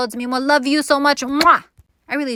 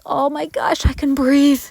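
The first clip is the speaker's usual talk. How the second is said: higher, louder burst of relieved joy; relief; 6 semitones higher, 5 dB louder, slower than usual. Retold or imitated. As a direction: gasp out the relief. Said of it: "I'm just like".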